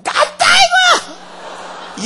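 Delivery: loud acted outburst; raging Jonah. Jonah protesting to God about the vine, raging. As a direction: throw a furious fit. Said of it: "난리가 나서".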